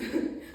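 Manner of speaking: short, loud laugh; a burst of laughter between lines; performed laugh. Acted. Poet after admitting lying to oneself, short loud laugh.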